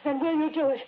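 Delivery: desperate pleading. Acted. Pleading for him to kill her.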